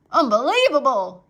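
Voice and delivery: outraged yell at full voice; shouting. Acted. Uncle shouting at the soldier in disbelief. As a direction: throw up his hands and bellow it. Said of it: "he shouted".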